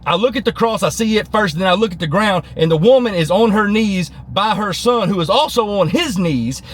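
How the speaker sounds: ordinary delivery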